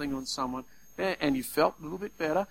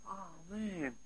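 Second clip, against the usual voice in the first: a much softer, hushed "oh, man"; hushed hurt sigh; 14 dB quieter than usual. Retold or imitated. Preacher voicing the wounded person's hushed reaction. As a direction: breathe it out under the breath as the wounded one.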